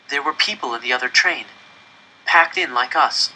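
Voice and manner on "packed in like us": ordinary delivery, nothing marked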